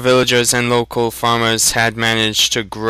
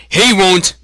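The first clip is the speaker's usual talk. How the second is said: loud, much higher outburst; accusing shout; 10 semitones higher, 5 dB louder than usual. Acted. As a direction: blurt it out, pointing. Shopkeeper accuses boy.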